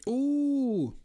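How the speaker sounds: long, high, loud "ooh"; excited "ooh". Real, not acted. Player reacting to a dice roll, loud excited ooh. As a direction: let out a high-pitched, drawn-out ooh.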